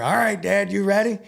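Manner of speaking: high, playful call to Dad; humoring. Retold or imitated. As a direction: play along with mom, voice lifted. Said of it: "kinda humoring her".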